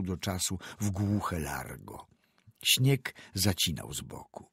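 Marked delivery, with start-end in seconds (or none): none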